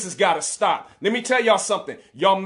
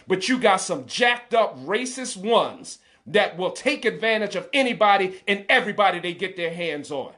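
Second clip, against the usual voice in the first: ordinary talk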